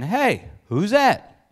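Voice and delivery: slow, drawn-out mock curious call; nosy townsmen. Men of Gerar eyeing the beautiful wife, mock curious. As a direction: stretch it out like gawking men. Acted men asking about Rebekah.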